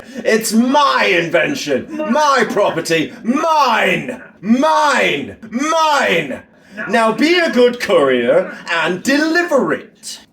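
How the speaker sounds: high, slow shouted "mine, mine, mine"; mock angry rehearsal. Game villain rants at the courier, high and shouting. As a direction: rehearse the villain's outburst, slowly. Practice take of game monologue.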